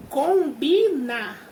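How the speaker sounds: slow, stretched syllables in each word; teacher spelling out word parts by ear; drawn-out demonstration. Shown as a demonstration. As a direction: sound out "Combinar, combinado, combinação" slowly for a class. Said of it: "Pensa aí, ó".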